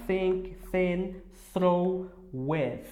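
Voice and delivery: Think, thin, throw, with with the tongue between the teeth; careful English th words; English th demo. Shown as a demonstration. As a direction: bite the tongue on each th. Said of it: "dessa forma que você vai pronunciar".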